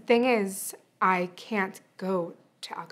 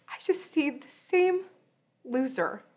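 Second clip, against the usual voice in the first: breaking voice; 7 semitones higher than usual. Acted. Hurt accusation to absent father.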